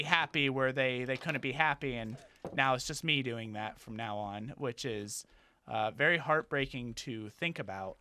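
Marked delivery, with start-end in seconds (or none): 1.9-8.0 sad. Real, not acted. Grieving lost friend.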